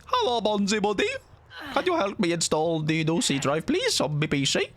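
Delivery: hammed-up high-pitched request; silly pleading caller. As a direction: ask like a clueless computer user. Voices naive plea to Bonzi Buddy.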